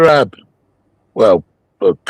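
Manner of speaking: ordinary talk, nothing marked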